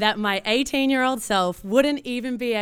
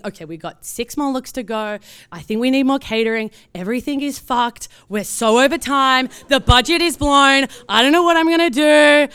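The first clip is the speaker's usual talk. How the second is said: rising panic, getting louder and higher to the end; panicked thoughts; 4 semitones higher, 8 dB louder than usual. Retold or imitated. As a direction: spiral into stress, word by word louder. Panicking inner thoughts mid-shoot.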